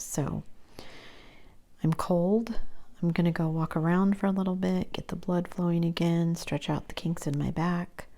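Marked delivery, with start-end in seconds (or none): none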